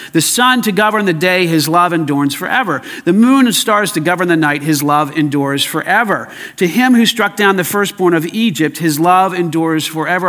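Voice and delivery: loud, rhythmic scripture recitation; emphatic psalm reading. Real, not acted. Preacher reciting the repeated psalm to the congregation, emphatic. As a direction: declare each verse and drive home the refrain.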